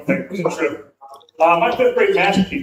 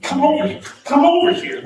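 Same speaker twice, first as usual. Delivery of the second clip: rising mock shout, higher on the second over here; retold coach yell; 8 semitones higher than usual. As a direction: call him over like a coach yelling. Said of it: "Coach is yelling my name".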